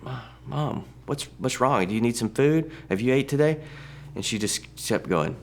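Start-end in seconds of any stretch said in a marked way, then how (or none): 0.0-3.6 higher, alarmed questions to a dazed mother; worried urging. Retold or imitated. Son alarmed at his confused mother, calling her. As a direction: call to her, suddenly scared.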